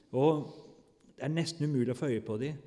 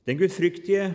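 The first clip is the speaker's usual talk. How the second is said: much louder, higher proclaiming; emphatic preaching; 6 semitones higher, 11 dB louder than usual. Real, not acted. Preacher to congregation, emphatic proclamation. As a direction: hit it from the pulpit. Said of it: "preacher emphasizes point".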